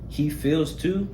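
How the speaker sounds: ordinary delivery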